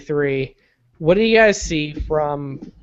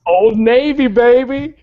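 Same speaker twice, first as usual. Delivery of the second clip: higher, louder brand boast; hammed-up cheer; 6 semitones higher, 6 dB louder, slower than usual. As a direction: hype it like an ad.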